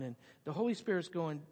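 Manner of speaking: ordinary talk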